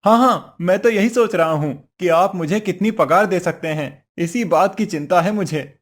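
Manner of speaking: a woodcutter feigning eagerness to the devil; eager agreement. Acted. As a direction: pounce on the offer eagerly. Woodcutter eagerly plays along.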